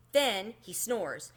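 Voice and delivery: ordinary delivery, nothing marked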